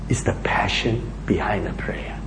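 soft, half-whispered preaching; hushed earnest appeal. Real, not acted. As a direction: drop right down and press it home intimately. Preacher presses an intimate point home.

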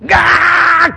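a sudden loud, high cry of rage; hammed-up demon yell; demon's angry roar. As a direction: let the demon's fury burst out. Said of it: "and they go".

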